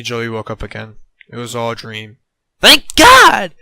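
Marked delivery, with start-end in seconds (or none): 2.6-3.5 high cartoon voice. A narrator's reading. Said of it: "Joey muttered under his breath".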